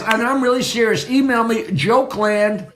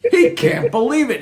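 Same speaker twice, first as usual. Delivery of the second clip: ordinary delivery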